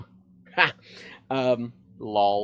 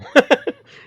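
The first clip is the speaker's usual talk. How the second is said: high loud laugh; 13 dB louder than usual. Real, not acted. Laughing at the imagined police stop.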